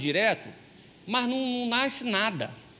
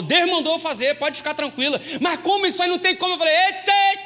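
mock high back-and-forth; 8 semitones higher, 5 dB louder than usual. Retold marital exchange, rising pitch.

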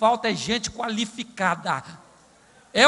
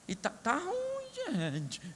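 mock crying; 7 semitones higher, 8 dB quieter, much slower than usual. Mock lament about pay.

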